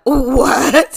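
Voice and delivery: loud, high-pitched "what?"; shocked outburst. Retold or imitated. Applicant hears the restaurant never posted the ad, shocked yell. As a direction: retell it as a stunned yell. Shocked reply to being told no.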